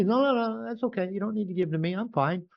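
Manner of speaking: high, eager brush-off of help; light mock of a people-pleaser; mock nice guy. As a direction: play the self-effacing pushover.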